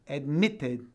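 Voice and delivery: "Admitted" in British English with a hard T; British accent, release the T clearly; British, marked T. Shown as a demonstration. Teacher showing students the T, British accent. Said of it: "com um T bem marcado".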